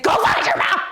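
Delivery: put-on high, loud bossy shout at a kid; retold yell. Yelling at the kid.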